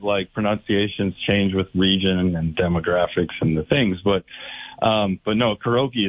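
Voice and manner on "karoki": karaoke said as "kuh-ROH-kee"; mistaken "karoki" pronunciation. Retold or imitated. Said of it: "is just way off".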